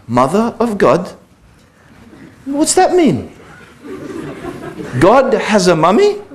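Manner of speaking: amused high-pitched disbelief; childlike bafflement. Retold or imitated. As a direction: play his eight-year-old self, baffled. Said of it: "I remember thinking to myself".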